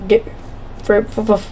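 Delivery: stumbling over the first word; tripping on the words, stuttering. Real, not acted. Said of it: "oh my holy stutter".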